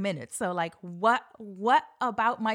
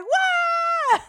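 imitated roar, very high and loud; long shouted whoop of a thrilled audience; crowd cheer; 11 dB louder than usual. Audience cheering the keynote speaker, wildly excited. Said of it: "And everybody's like".

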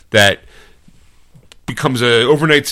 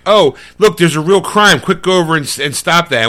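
higher, louder excited bystanders; eager onlookers; 6 semitones higher, 3 dB louder than usual. Retold or imitated. Onlookers urging the hero on, higher and louder. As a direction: egg him on to go stop the crime. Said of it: "'cause they were like".

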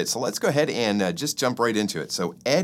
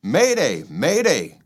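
higher, louder alarm call; 5 semitones higher, 4 dB louder than usual. Acted. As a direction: sound the alarm as you run. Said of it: "called a tick as it fled".

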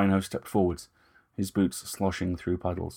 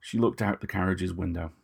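ordinary delivery

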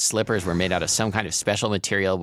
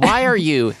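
higher, louder, cut off mid-question; startled challenge; 11 semitones higher, 7 dB louder than usual. A narrator's reading. Mother confronted by her daughter, startled. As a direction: blurt it out, caught off guard.

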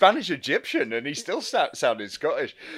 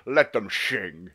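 Sean Connery; 10 semitones lower, 4 dB quieter, much slower than usual. Retold or imitated. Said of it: "and he just goes".

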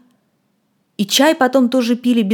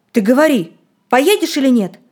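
demanding; 4 semitones higher, 5 dB louder than usual. Acted. Boy demanding an answer.

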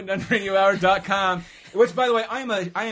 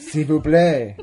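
mock French accent; 10 dB louder, slower than usual.

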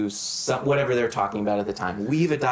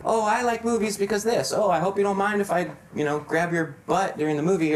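higher-pitched, mock-chirpy soundbite voices; ad montage moviegoers; 8 semitones higher than usual. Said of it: "this little montage of".

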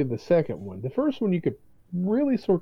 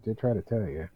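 lower, softer apologetic admission; sheepish told-you-so; 7 semitones lower, 6 dB quieter than usual. Retold or imitated. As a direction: say it quietly, almost sorry. Himself saying told you so.

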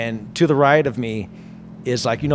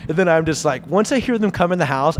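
ordinary delivery

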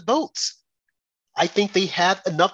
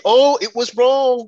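loud, slow mock protest, pitched higher; slow, raised voice parodying Democrats; mock outrage; 8 semitones higher, 7 dB louder, slower than usual. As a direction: ham up their outraged complaint. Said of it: "and they can say".

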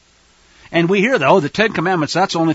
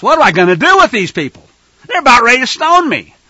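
louder, exasperated complaint; frustrated Moses; 6 semitones higher, 8 dB louder than usual. Acted. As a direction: throw up your hands in exasperation. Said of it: "I can relate to his frustration".

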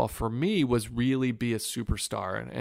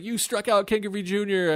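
much higher, choked-up big brother; crying brother; 10 semitones higher, 3 dB louder than usual. Retold or imitated. Brother answering the phone already crying, much higher and choked up. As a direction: pitch it way up like a brother near tears. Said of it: "he's crying already".

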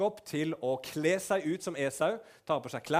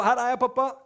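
much higher, loud cheerful call; Esau bursting in; 9 semitones higher, 10 dB louder than usual. Retold or imitated. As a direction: burst in calling proudly to your dad. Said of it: "Esau kommer inn rett etterpå".

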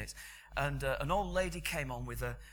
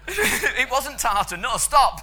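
laughing; 9 semitones higher, 11 dB louder than usual. Real, not acted. Laughing through shopping trolley aside.

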